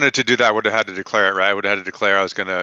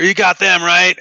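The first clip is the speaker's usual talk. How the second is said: loud, higher-pitched call to an ally; yelling; 10 semitones higher, 9 dB louder, slower than usual. Acted. Player's character yells at the Minotaur before moving on, yelling. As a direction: yell it across a fight. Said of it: "he yells at the Minotaur".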